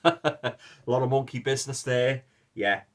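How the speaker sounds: chuckling through the pun; laughing. Real, not acted. Chuckling at his own joke about the ape-heart film. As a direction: laugh the words out.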